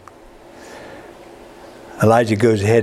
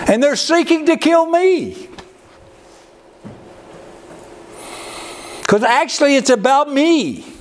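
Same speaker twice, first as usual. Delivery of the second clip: mock self-pity. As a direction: ham up the wounded ego. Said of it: "preacher mocking the self-pity".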